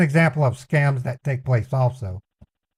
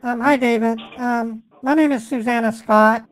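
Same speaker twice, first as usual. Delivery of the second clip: put-on caller voice; 6 semitones higher than usual. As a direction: play an innocent caller, pitched up. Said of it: "I have to literally pretend".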